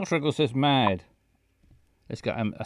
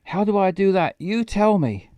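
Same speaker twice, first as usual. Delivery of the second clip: exasperated character line; 6 semitones higher, 5 dB louder than usual. Acted.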